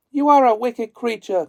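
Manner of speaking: high, loud accusing cry; Dorothy crying out. A narrator's reading. Dorothy accuses the witch, high and loud. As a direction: hurl it at her, outraged. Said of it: "cried Dorothy".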